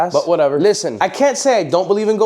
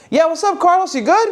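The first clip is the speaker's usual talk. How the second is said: pitched-up cheerful greeting; much higher friendly voice; 12 semitones higher than usual. Retold or imitated. [name] answers Carlos with a high cheerful greeting. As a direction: greet him like an old buddy. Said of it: "I was like".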